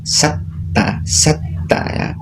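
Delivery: breathy whispered satta, tt held; whispered sound demo. Shown as a demonstration. Teacher showing the sound to students, whispered demo. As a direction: whisper satta with the s running into a doubled tt. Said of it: "antara sin dengan ta".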